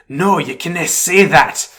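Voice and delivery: a thick Scottish groundskeeper warning against a lawsuit; Groundskeeper Willie Scottish accent. Retold or imitated. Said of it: "That's a pretty good accent".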